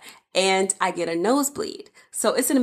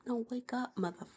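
urgent hushed whisper, much softer; whispered wake-up; 5 semitones higher, 13 dB quieter than usual. Retold or imitated. Wife whispering at sleeping husband to wake up. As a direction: hiss it like nudging someone awake at night.

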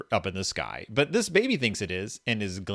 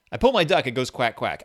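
put-on reading to a toddler; 6 dB louder than usual. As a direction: read it like to a baby. Rereads baby book line.

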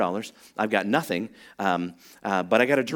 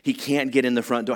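ordinary talk

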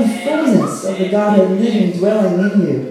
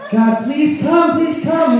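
mock plea, pitched high and slow; pleading; 7 semitones higher, slower than usual. Preacher imitates the religious begging God to come, pleading. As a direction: beg with raised, drawn-out words. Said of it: "then comes the pleading".

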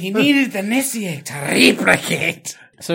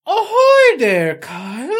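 very high, loud, slow hammed-up ahoy with a laugh; over-the-top sailor greeting; mock sailor hail; 8 semitones higher, 7 dB louder, slower than usual. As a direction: hail Kyle like a cartoon sailor.

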